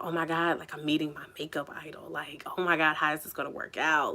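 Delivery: whispered, rushed with nerves, under the breath; breathless star-struck whisper to herself; nervous inner whisper. Retold or imitated. Meeting her makeup idol, nervous whisper to herself. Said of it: "that nervous feeling".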